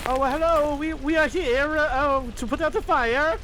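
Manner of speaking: put-on high-pitched comic Italian accent; squeaky, hesitant Italian helper; cartoon Italian fireman.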